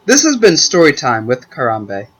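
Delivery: a cheesy host wrapping up the show; educational TV show sign-off. Acted. Storyteller closes his podcast, TV host sign-off. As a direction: sign off like a kids' TV presenter. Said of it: "sounds like an educational T V show ending".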